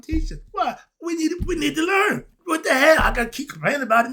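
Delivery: real annoyance, a fast burst at the end; annoyed outburst. Real, not acted. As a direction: let the annoyance boil over. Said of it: "I'm annoyed".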